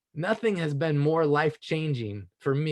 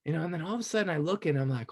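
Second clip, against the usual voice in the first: usual speaking voice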